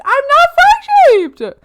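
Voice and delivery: high, loud, drawn-out protest; indignant wail. Acted. Streamer objects to being called frog shaped, wailing protest. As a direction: stretch it into an outraged wail.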